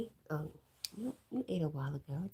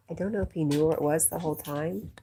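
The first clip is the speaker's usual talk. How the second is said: usual speaking voice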